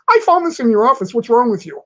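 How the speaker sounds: loud, high mock scolding, fast; hammed-up boss demanding answers. Boss confronting him about the underwear, loud mock scolding.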